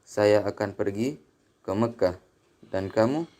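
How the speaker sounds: ordinary delivery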